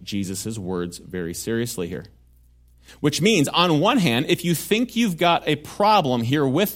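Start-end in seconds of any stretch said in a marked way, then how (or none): none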